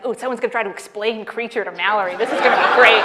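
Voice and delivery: bubbling over with anticipation; excited boyfriend. Retold or imitated. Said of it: "my boyfriend gets all excited".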